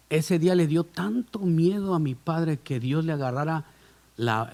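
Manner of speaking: usual speaking voice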